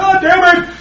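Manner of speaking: sudden high, loud mock outburst; a hammed-up angry dad yelling at the car; imitating dad's curse. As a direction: blow up like an impatient father. Father curses at wrong wrench.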